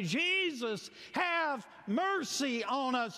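high, slow shouted plea; calling out in faith. Acted. Preacher leading the church to cry out to Jesus, shouting. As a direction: cry out for mercy. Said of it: "call out in faith and say".

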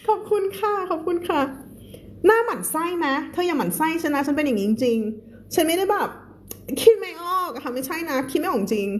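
high, fast flustered whine; playful whine. Real, not acted. Teacher pleading with viewers not to find her annoying. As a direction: act sheepish and a bit whiny.

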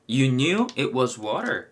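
slow English sentence, knew in focus; unhurried teacher model with knew stressed; slow demo line. Shown as a demonstration. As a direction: say it slowly for learners, leaning on knew. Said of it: "그거는 knew예요".

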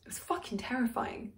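very soft, almost whispered admission; hushed confession. Acted. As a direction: let it out under your breath.

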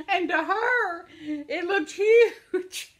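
high, loud laughing and slow. Real, not acted. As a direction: stretch it out between laughs. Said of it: "I'm laughing my head off".

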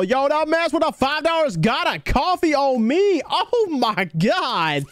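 high, hyped announcement stretching into amazement; hyped-up, drawn-out thanks; excited gift reaction. Real, not acted. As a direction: gush over a surprise gift, drawing out the end.

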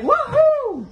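high, loud laughing whoop; a giddy high yelp of delight; mock orgasm whoop. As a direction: squeal it out like a climax. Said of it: "she's like".

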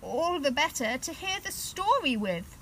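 wolf as Grandma. Pretend. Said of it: "said the wolf".

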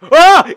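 startled scream. Real, not acted. Mob suddenly appears.

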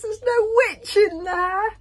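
very high, loud dragon squeal; Toothless. A narrator's reading. Scared little dragon seeking reassurance, squealing. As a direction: draw it out in a squeaky voice. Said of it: "said Toothless".

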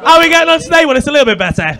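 loud, high opening, lightly acted manager; mock manager. Comic mocking continuous improvement manager's job, lightly acted loud check-in.

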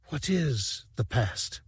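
a hint of a fragile alien rebel, gently reproachful; dying lamproid lover. A narrator's reading. Dice speaking to Sivrak.

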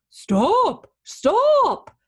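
high, loud hound's bark of a word. A narrator's reading. Dozing hound sees the runaway, barking out. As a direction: bark it out in two bursts. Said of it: "the hound barked".

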